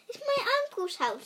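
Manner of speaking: high, fast and eager; excited princess. A narrator's reading. Princess spotting her uncle's palace, fast excited. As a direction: blurt it out with delight. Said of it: "said Princess".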